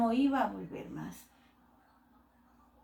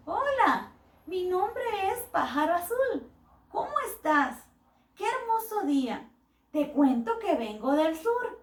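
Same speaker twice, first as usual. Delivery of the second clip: higher, lively little bird voice; chatty bird; 4 semitones higher, 4 dB louder than usual. Acted. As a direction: chirp it out like a cheerful traveling bird. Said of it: "le comenzó a hablar".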